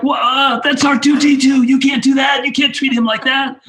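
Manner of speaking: high-pitched, loud, scandalised retelling; mock outraged protest. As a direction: act out appalled disbelief, voice shooting right up. Retells own shocked protest on set.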